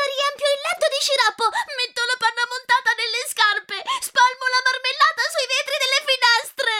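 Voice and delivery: put-on stamp your feet like a spoiled child; child's tantrum. Spoiled girl refuses and threatens her parents, high tantrum.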